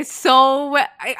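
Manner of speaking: ordinary talk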